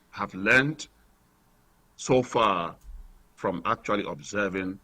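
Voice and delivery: caller on a phone line; thin, distant phone-line voice; radio show caller. Real, not acted.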